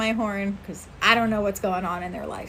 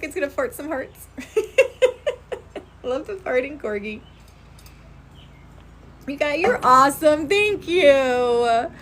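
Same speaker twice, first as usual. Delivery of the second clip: ordinary talk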